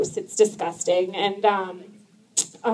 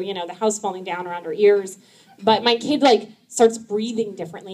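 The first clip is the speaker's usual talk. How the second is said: ordinary talk, nothing marked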